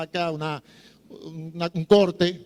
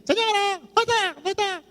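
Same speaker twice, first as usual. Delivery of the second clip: squeaky high parrot chatter. Retold or imitated. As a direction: squawk it like a talking parrot. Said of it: "pueden decir". (voz de loro, muy aguda)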